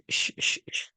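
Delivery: whispered shushing; hushing whisper. Retold or imitated. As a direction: hush the impulse in a soft whisper. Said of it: "your neocortex goes".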